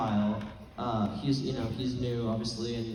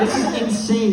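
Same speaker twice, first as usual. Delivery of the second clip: exasperated quote; 8 semitones higher, 9 dB louder than usual. Retold or imitated. Eduardo quoted loud.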